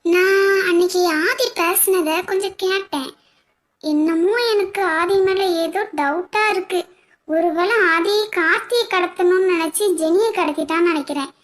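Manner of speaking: soft, private thinking-aloud; worried musing. Acted. Woman privately suspects a kidnapping plot, softly musing.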